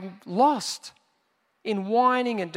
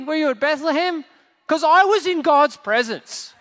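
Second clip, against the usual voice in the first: loud, higher-pitched mock rebuke; indignant angel; 6 semitones higher, 5 dB louder than usual. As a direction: play an affronted angel telling someone off.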